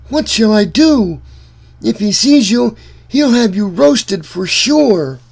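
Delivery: high, loud, urgent woman's warning; frightened giant's wife. Acted. Giant's wife urging Jack to hide, high and frantic. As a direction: hiss a panicked warning to hide.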